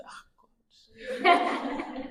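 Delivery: breathy mock whisper with a laugh; retold whisper. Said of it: "I just said".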